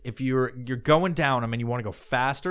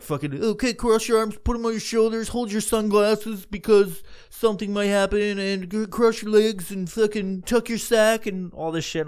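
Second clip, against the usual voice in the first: higher, mock-bored lifeguard instructions; 7 semitones higher than usual. Imitating the teen lifeguard giving slide rules. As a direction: rattle off the rules like a dull lifeguard. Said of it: "he's like".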